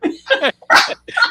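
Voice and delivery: high burst of laughter; loud high laugh. Real, not acted.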